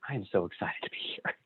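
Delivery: softly whispered aside; hushed confession. Pretend. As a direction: breathe it like a secret. Mock interview opener.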